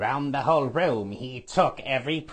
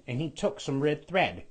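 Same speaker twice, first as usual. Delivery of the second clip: ordinary delivery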